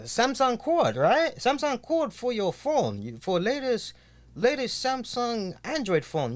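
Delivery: put-on high-pitched huckster in an unplaceable foreign accent; hammed-up foreign accent, broken-English sales patter; vague foreign accent. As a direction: hawk it in a made-up foreign accent. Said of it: "I'm just doing an accent".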